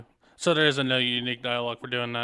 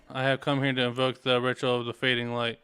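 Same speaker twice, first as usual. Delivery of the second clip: ordinary talk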